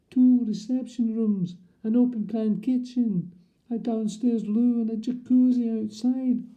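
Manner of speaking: high, loud, indignant; posh indignant correction of her surname. Retold or imitated. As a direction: correct them, offended and insistent.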